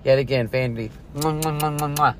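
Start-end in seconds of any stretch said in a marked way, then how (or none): 1.2-2.1 smacking air kisses, chef style; chef's kiss. Real, not acted. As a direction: blow kisses to praise the food. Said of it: "Chef's kiss".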